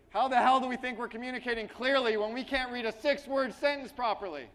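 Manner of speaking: loud, high-pitched exasperated shout; exasperated outburst. Real, not acted. Coach scolding audience after failed reading exercise, exasperated shout. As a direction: berate the room at full volume, exasperated.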